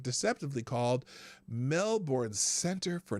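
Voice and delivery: usual speaking voice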